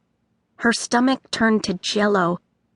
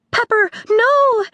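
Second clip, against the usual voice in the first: high loud alarmed cry; Nori crying out; 13 semitones higher, 4 dB louder than usual. A narrator's reading. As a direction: cry out to stop her. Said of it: "Nori cried".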